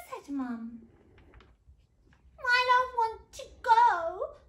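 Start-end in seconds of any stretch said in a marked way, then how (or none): 2.4-4.4 high, loud, drawn-out protest; hammed-up tearful wail; sobbing princess. A narrator's reading. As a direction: whine it slowly like a tearful child. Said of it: "sobbed the little princess".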